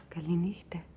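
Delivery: softly whispered Καληνύχτα, as if to someone beside her; girl whispering goodnight to her lost fiancé. Retold or imitated. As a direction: breathe the word with no voice at all. Girl retells bidding dead fiancé goodnight.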